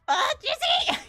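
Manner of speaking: high, loud mock-distraught cry; fangirl scream. Fan cries out for a favourite character, hammed-up anguish. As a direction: squeal the name in hammed-up longing.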